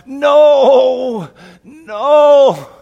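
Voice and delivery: a long, high, full-throated scream. Acted. Esau screams to the heavens in full despair. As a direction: scream your grief to the heavens. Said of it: "he screams out".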